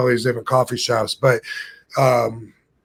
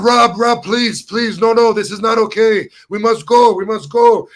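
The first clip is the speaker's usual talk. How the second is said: higher, frantic begging; scared pleading; 8 semitones higher, 4 dB louder than usual. Retold or imitated. Scared friend begging Rob to leave the restaurant, frantic. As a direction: plead with him in a panic to leave. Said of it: "Martine was so scared".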